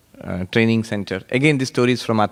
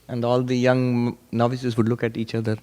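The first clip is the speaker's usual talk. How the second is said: ordinary talk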